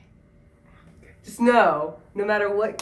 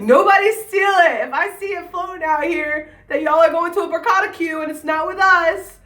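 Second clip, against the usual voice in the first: playful loud warning; 10 semitones higher, 8 dB louder than usual. Real, not acted. Playful warning about the event name.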